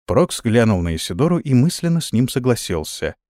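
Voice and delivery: usual speaking voice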